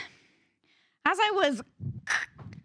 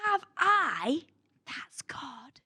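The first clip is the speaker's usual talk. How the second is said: whispered aside; hushed whisper; much slower than usual. Acted. Whispered verse reading.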